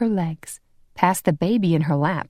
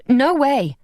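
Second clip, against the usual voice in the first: protesting; 7 semitones higher, 4 dB louder than usual. A narrator's reading. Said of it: "Vonda protested".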